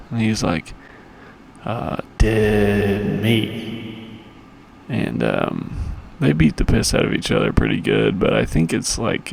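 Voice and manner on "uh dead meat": menacing Clubber Lang. Retold or imitated. Clubber Lang quoted answering crowd.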